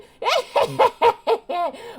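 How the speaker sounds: high cackling granny laugh; old lady cackle. Acted. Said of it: "my old lady voice".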